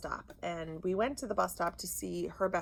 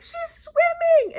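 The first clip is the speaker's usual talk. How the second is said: very high, loud, drawn-out holler; retold calling out; 10 dB louder, slower than usual. Boy reporting on the husky in the pond, hollering. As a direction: call it slowly as if across a pond.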